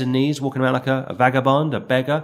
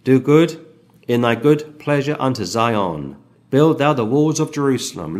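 scripture reading; 2 semitones higher, 4 dB louder than usual. Acted. Reads the psalm verse aloud.

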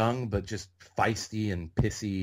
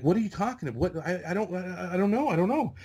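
very high, stammering imitation of panic; flustered denial; 9 semitones higher, faster than usual. Newcomer denying any connections to extras, high and flustered. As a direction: stumble over it, pitch shooting up.

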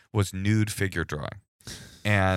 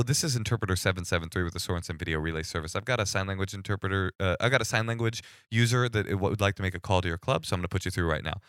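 rushed relay spiel; 3 dB quieter, faster than usual. Shown as a demonstration. Relay interpreter to club before they hang up, rushed. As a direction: rattle it off in one quick breath. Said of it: "try to do it so quickly".

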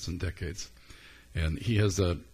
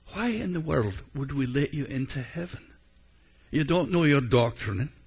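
Saint Peter scolding in a Scottish brogue, higher; 6 semitones higher, 4 dB louder than usual. Retold or imitated. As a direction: build to a stern Scottish rebuke. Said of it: "in Alistair's brogue".

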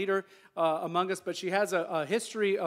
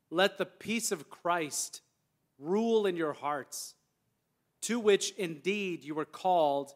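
measured, solemn Bible reading with long pauses; slow, deliberate reading aloud; scripture reading. Real, not acted. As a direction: read scripture to the congregation, phrase by phrase. Reads scripture aloud to congregation.

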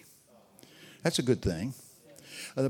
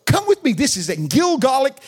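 excited shout; 11 semitones higher, 9 dB louder than usual. Real, not acted. Preacher launching into his Ireland story, excited and loud. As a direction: call it out with delight.

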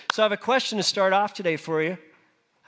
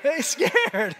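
mock scared voice, higher with a laugh; terrified; 6 semitones higher than usual. Shown as a demonstration. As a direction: act scared for the crowd, playfully. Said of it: "say it like you're scared".

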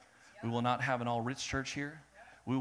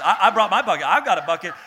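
loud, higher, possessive boasting; proud bucket owner; 5 semitones higher, 11 dB louder, faster than usual. Acted. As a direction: brag about your bucket like a treasure. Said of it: "a lot of us came to church like this".

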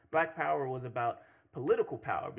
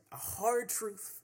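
normal talking voice

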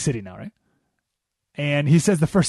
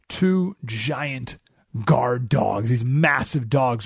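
usual speaking voice

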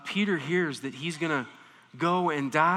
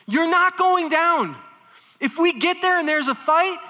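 intense; 11 semitones higher, 7 dB louder than usual. Retold or imitated. Peter vowing to Jesus, loud and intense. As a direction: declare it with fierce intensity. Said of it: "He's saying this with intensity".

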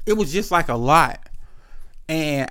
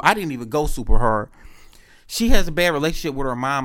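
ordinary talk

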